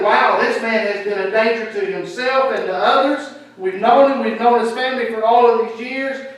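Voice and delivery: lightly voiced excited neighbours, loud and high; mildly hammed-up cheer for a healed friend; joyful townsfolk. Imagined townsfolk rejoicing.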